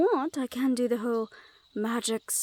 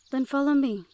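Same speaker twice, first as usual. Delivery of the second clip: louder, higher, insistent order; firmer command; 4 semitones higher, 5 dB louder than usual. Acted. Fae guard orders the stubborn Hellhound to follow, louder. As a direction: make it an order now. I thought as much.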